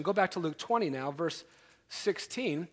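ordinary talk

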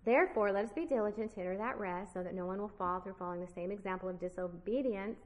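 fast, running read of the verse; brisk read-through; read quickly. Shown as a demonstration. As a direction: rush through it as a lead-in. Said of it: "We could read it like this".

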